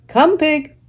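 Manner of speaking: high, loud coaxing call; farmer calling. Acted. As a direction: call the pig to the pail.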